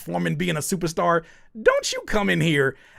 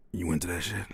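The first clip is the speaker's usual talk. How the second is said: low, soft imitation; deep mocking voice; 9 semitones lower, 8 dB quieter than usual. Showing friend how they sounded, deep mocking voice. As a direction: drop way down to mimic the question. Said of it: "When you talk like that".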